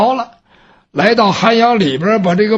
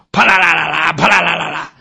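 gong clatter; 5 semitones lower, faster than usual. Acted. Imitating the broken gong.

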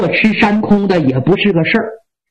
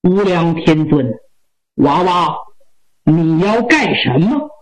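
suppressed anger; 6 semitones lower than usual. A narrator's reading. Old Taoist confronting the boy, forcing his anger down. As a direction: keep the temper down, low and measured. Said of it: "强压着火".

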